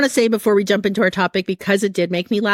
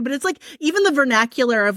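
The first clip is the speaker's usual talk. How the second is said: ordinary talk, nothing marked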